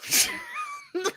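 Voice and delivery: squeaky pained laugh; high laughing whine. Real, not acted. Joke about everyone learning Korean.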